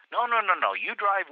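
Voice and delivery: insisting policeman. Retold or imitated.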